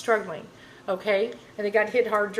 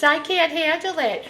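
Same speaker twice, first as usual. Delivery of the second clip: mocking a Karen in a higher voice; 7 semitones higher than usual. Mocking an entitled Karen at work, higher mock voice. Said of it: "Oh, you, you Karens".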